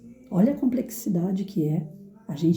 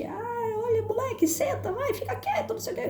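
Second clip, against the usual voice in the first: mock yelling; 5 dB quieter than usual. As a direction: imitate a teacher losing it at a pupil. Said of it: "Isso é gritar. É se desequilibrar".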